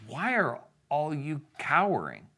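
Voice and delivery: high-pitched, incredulous question; incredulous challenge. Acted. David questioning the cowering army, incredulous. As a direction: challenge them, baffled by their fear.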